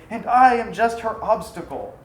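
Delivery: husband bitterly admitting he is her obstacle. Acted. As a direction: spit out the bitterness. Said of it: "adding bitterly".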